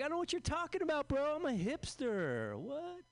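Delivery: high, fast, squeaky denial; mock defensive. Comic brushing off coworker's taunt, high and defensive. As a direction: play dumb and dodge.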